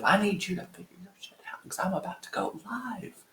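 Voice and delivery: put-on hissed, tight threat at a child; menacing whisper. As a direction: whisper the threat like a scary mother. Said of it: "Total Mommy Dearest moment".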